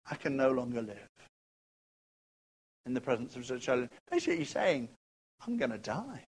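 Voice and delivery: soft, low voice of a doomed man; quiet dread; hushed despair. Acted. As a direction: speak it as a near-whispered confession. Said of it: "voicing Isaiah's despair softly".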